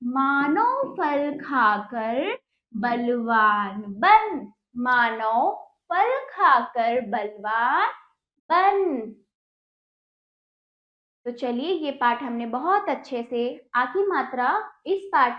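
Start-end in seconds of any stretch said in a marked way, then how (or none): 0.0-9.1 sentence read in slow chunks, each said twice; reading to children. Acted. Teacher to children, reading the Ram lesson line by line. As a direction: read it out so the class can repeat.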